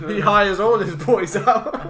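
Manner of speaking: loud, high, drawn-out laughing line. Real, not acted. Podcaster cracking up at own boys joke, laughing.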